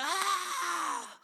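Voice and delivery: high, drawn-out "ah"; excited cry. Acted. Showing how exciting church was.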